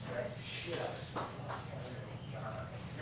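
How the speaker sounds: whispered, slow aside to himself; under-breath mutter. Real, not acted. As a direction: mutter it so nobody hears, slowly. Muttered aside after leaving podium.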